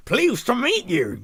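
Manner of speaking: loud, high, drawn-out attempt at a chorus; hammed-up speaking in unison, slow and high; two voices at once. Two characters greeting each other at the same time, mock chorus. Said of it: "they both said at the same time".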